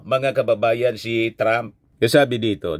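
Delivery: ordinary talk, nothing marked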